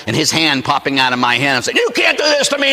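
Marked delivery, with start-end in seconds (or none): 1.8-2.7 high-pitched mock scream of protest; retold scream. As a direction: cry out high, acting out panic. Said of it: "I'm screaming at the devil".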